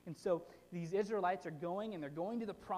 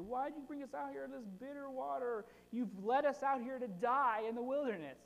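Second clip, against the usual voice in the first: whiny griping Israelites; 7 semitones higher than usual. Retold or imitated. Preacher voicing Israelites griping to Moses in a high whine. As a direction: whine like ungrateful wanderers. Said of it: "they complain, and they gripe".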